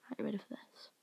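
soft whispered question. Real, not acted. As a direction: whisper it to the viewers. Whispered to camera.

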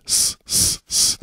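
sharp, hissy s, s, s; sustained sharp s hisses; hissed S sounds. Shown as a demonstration. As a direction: hiss out a sharp sibilant s. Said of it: "it's very sharp and sibilant".

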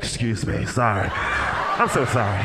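low, slow, sheepish apologies; low awkward excuse me; mock polite apology. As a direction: sheepishly squeeze past a row of strangers. Squeezing past passengers.

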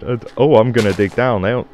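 ordinary talk

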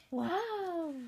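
gasp, then a high amazed wow. Acted. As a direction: sound delighted and amazed. Adult reacts to the candy house.

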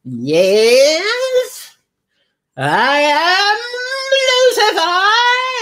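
high, loud, drawn-out hammy devil voice; stretched, shouted devil voice. Retold or imitated. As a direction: ham up a demonic Lucifer, slow and loud. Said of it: "I put on my best devil voice".

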